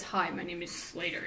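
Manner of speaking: lightly voiced deep, gravelly stranger; a hint of a deep, gravelly caller. A narrator's reading. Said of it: "in a very deep and gravelly voice".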